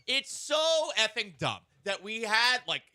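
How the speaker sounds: high, loud and slow; exasperated outburst. Real, not acted. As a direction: let the frustration boil over. Exasperated rant about show name.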